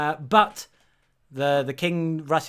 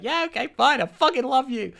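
high-pitched, hammed-up giving in; exasperated mock confession; 10 semitones higher than usual. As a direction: blurt out love as if cornered. Acted grudging love confession.

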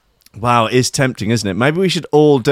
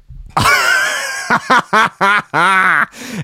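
gleeful laugh; 8 semitones higher than usual. Real, not acted. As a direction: cackle at your own scheme. Laughs at his prank idea.

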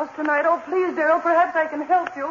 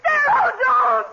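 terrified scream; 9 semitones higher, 4 dB louder, slower than usual. Acted. Mary screams as he attacks.

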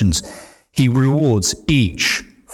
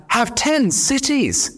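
high, beaming praise of the master, higher; delighted master; 12 semitones higher, slower than usual. Acted. Master hands servant ten cities, delighted and higher. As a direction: announce the reward with glee.

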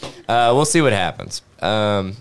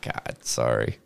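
quiet; 11 semitones lower, 9 dB quieter, slower than usual. Retold or imitated. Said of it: "And I was like".

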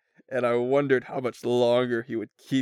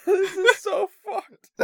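high, slow, laugh-cry; wheezing with laughter; 4 dB louder, slower than usual. Real, not acted. Cracking up at the story.

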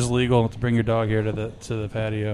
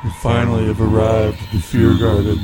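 spooky voice; 3 dB louder than usual. Pretend. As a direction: announce it like a possessed host. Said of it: "we're coming back to normal here".

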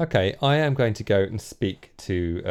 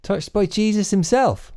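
high, incredulous echo; wide-eyed disbelief at the peddler's boast; mock astonishment; 11 semitones higher than usual. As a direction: gasp at the holy claim, playing along.

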